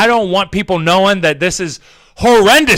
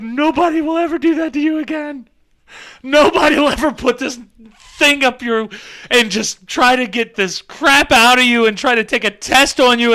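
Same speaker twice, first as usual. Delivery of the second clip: mock consoling; 6 semitones higher than usual. Consoling his nostril.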